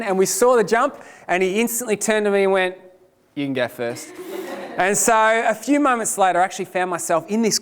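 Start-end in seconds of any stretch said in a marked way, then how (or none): 3.4-4.1 sheepish backing down. Retold or imitated. Brother backs out at venue.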